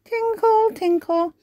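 very high chiming tinkle; Holly's wand sound; magic tinkle. Acted. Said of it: "Holly waves her magic wand".

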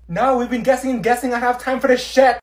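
loud, high, exasperated shout; fed up, let it out; frustrated shout. Acted. Frustrated friend snaps at group.

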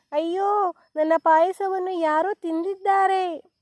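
high, wailing little bear; storybook baby bear's high wail; crying baby bear. A narrator's reading. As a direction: squeal it out like an upset cub. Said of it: "ಎಂದು ಕೂಗಿತು".

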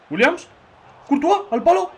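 sudden high, loud call of the chance; excited outburst. Real, not acted. Streamer reacting live to a shot, high excited call. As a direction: yelp it out as the shot hits the post. Gamer watching a close chance.

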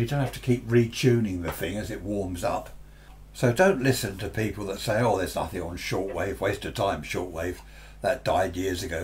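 ordinary talk

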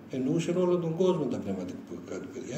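usual speaking voice

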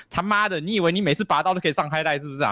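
mock exasperated policeman; mocking cop. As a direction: sneer at him like a fed-up cop. Acted cop mocking the hero.

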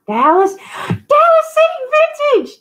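loud, soaring squeal of welcome; excited shout-out. Real, not acted. Host spotting a favorite YouTuber in chat, excited. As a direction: shriek the name with delight. Calling out an arriving favorite viewer.

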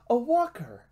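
high, loud delighted exclamation; excited surprise. A narrator's reading. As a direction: burst out at thrilling news. Boy thrilled at piloting news.

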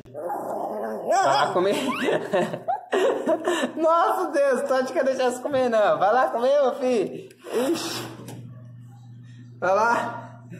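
high coaxing singsong with a laugh. Real, not acted. Owner coaxing the timid dog to eat, in high baby talk. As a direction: coax the puppy to go eat, high and sweet.

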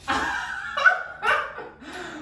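high burst of laughter. Real, not acted. Laughing at herself.

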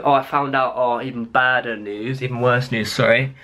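usual speaking voice

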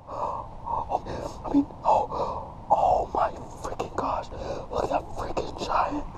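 voiceless, overwhelmed near-whisper; breathless astonishment. Real, not acted. Angler staring at a giant fish, breathless astonishment. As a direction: gasp it out in disbelief.

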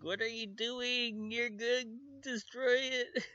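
high-pitched alarmed squeal; horrified geek. Retold or imitated. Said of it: "The guy's like".

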